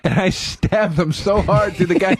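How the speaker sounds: high, slow, seething imitation; imitating a friend getting worked up. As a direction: draw it out high, savouring the fantasy. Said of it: "he would get so worked up".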